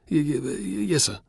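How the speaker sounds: stuttering out the yes in fear; frightened stammer. Acted. Boy facing the dreaded Spartacus, stammering in fear. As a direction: trip over the first sound, scared.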